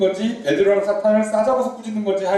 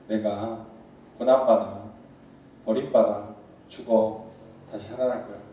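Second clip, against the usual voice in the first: Jesus confessing; 9 semitones lower, 5 dB quieter than usual. Retold or imitated. Preacher voices Jesus telling disciples his fate, low solemn confession. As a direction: let each suffering drop low and quiet like a confession. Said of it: "예수님 고백하시죠".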